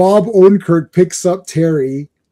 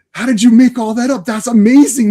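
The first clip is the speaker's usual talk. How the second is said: retold amazement, raised and louder; Terry; 6 semitones higher, 5 dB louder than usual. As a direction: gush over someone's brilliant invention. Retold Terry amazed by invented story.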